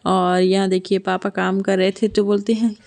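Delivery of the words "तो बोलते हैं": ordinary delivery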